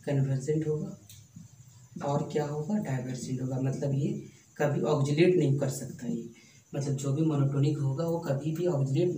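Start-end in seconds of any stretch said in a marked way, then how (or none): none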